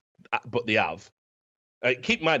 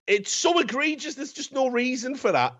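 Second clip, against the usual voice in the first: much higher, pleading protest; exasperated disbelief; 10 semitones higher than usual. Real, not acted. Film fan protesting the dog's killing, exasperated disbelief. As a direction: pitch it right up in comic outrage.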